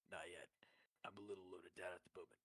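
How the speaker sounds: low, whispery game-hero voice; quiet, quick, understated soldier impression; Master Chief. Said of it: "really like the Master Chief was".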